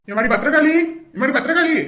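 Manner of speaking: repeated calling of a name. Acted. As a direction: summon her from another room. Calls the woman from elsewhere.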